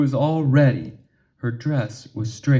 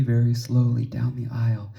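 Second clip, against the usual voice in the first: ordinary delivery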